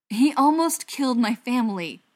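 loud, heated insistence; high, angry appeal; pressing. A narrator's reading. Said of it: "Butcher pressed".

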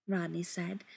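usual speaking voice

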